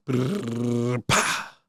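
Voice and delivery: lip-trilled drumroll with a popped finish; mock drumroll. Revealing his test score with a mouth drumroll. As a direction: build suspense with a mouth drumroll.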